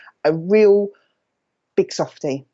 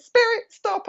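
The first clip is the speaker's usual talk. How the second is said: high sharp call of stop; retold call to stop. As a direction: call out to halt the spirit. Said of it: "and I went".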